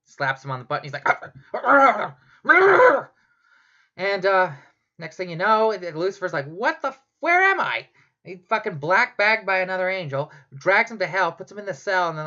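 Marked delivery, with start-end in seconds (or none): 1.1-3.1 rising yelps that climb much higher and louder; hammed-up squeals after a slap; mock moans. Lucifer slapped on the butt. 6.5-7.9 a sudden high, bewildered outburst; startled confusion. Acted. Lucifer wakes up dragged off.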